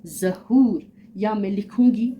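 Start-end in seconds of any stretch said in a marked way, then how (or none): 0.1-0.9 ज़हूर with the waw said open and full, a long clear oo; teacher modelling ज़हूर with a full open oo; waw maroof demo. Shown as a demonstration. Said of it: "वाव मारूफ वो वाव है जो".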